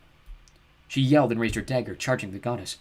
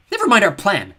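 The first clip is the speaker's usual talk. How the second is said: loud angry snarl; 10 semitones higher, 12 dB louder than usual. Acted. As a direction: snarl it at her. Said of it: "Chione snarled".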